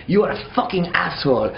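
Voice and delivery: put-on French-accented yell, first take; angry French accent. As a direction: spit out "asshole" like a drunk Frenchman. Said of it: "it's like a French dude".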